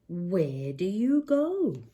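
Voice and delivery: ordinary delivery, nothing marked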